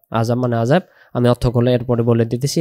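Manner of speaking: ordinary delivery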